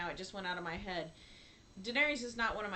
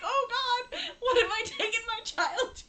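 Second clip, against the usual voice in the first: high, laughing, a bit louder dismay; laughing mock horror; 13 semitones higher, 8 dB louder than usual. Mom imitating her own horror at the movie, laughing high. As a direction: laugh out a parent's guilty panic. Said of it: "And you're like".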